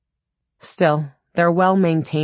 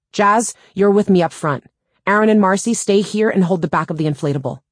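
raised, higher commanding voice; calling out orders; 6 semitones higher, 5 dB louder than usual. A narrator's reading. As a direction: take charge and call it out to the crew. Team leader giving urgent orders.

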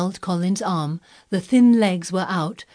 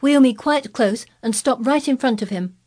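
put-on higher-pitched imperious boy; commanding; 7 semitones higher, 4 dB louder than usual. A narrator's reading. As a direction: give orders like a little lord. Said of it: "he commanded".

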